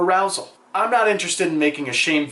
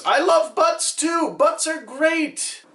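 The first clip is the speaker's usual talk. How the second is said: mock gushing; 12 semitones higher, slower than usual. Mock-gushing about butts.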